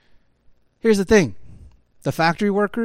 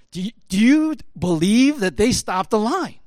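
higher-pitched, amazed disbelief; incredulous retelling; 4 semitones higher, 3 dB louder than usual. Retold or imitated. Workers marvelling to their buddies, incredulous. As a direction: ask it as if you can hardly believe it.

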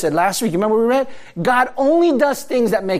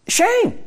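"Shame" cried out much higher in pitch; emphatic one-word answer; 12 semitones higher than usual. Acted. Preacher answering his own question to the congregation, a single high emphatic "Shame". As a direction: land "Shame" high and ringing, a single word hung in the air.

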